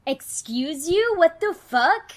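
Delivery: loud, high, indignant challenge; pissed off. Acted. Nikki defending her splashed partner, indignant and pissed off. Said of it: "really pissed off".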